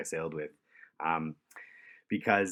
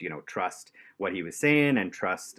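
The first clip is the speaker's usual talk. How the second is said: ordinary talk, nothing marked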